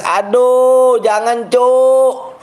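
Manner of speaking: drawn-out begging no; high pleading wail. Real, not acted. Begs not to trade account.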